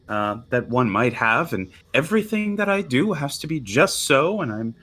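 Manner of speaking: mock the high-and-mighty expectations; grandiose mocking. Nualia mocking what her father demands of her, grand and sneering. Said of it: "grandiose, sort of, like, mocking".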